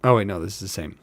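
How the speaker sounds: plain reading voice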